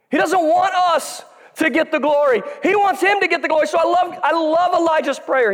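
high, ringing preacher's voice; preaching. Real, not acted. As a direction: preach it with conviction. Preaching at full force.

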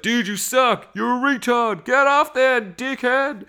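high, loud jeering heckler voice; heckling crowd clip. Retold or imitated. Crowd heckling JB on stage, high mocking jeers. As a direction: jeer him off the stage in a squeaky put-on voice. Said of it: "Doing these sound clips".

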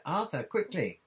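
high, loud call to hurry; urgent cry. A narrator's reading. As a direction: cry out to a husband about to miss it. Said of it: "cried Missus Weasley".

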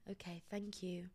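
breathy whispered reply; whispered thanks. Retold or imitated. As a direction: whisper it, worn down by the clerk.

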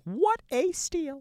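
high, slow, put-on commercial tagline. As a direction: sell the bargain like an ad. Mock ad tagline about price.

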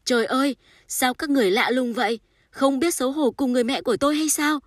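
high, loud, anguished outcry; suppressed scream. A narrator's reading. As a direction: cry out in outrage, barely holding back a scream. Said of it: "cố kìm lấy tiếng la hét".

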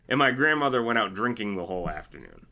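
ordinary delivery

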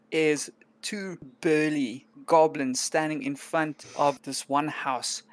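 ordinary delivery

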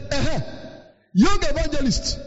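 high, mocking elder voice. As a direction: tease him from above. Elders mock the young man.

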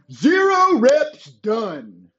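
slow, smug mock of a commenter. Mocking a commenter, slow and smug. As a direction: drawl it like a smug know-it-all.

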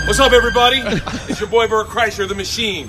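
loud, high hype-man promo; hyped promo. Retold or imitated. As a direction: pump up the Netflix special. Hyped promo video replayed.

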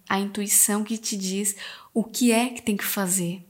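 soft whisper of intuition. Acted. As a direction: breathe it like a secret. Sussurra a voz da intuição. (sussurrando suavemente)

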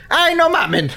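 high, drawn-out mock outrage; retold exasperation. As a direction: imitate someone reacting in disbelief. Acts sister's reaction to finale.

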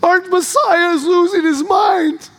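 frantic disciples, voice climbing and cracking; panicked cry. Retold or imitated.

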